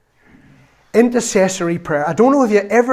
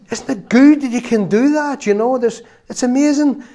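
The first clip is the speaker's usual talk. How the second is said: excited; 8 semitones higher, 5 dB louder than usual. Real, not acted. Excited praise of God.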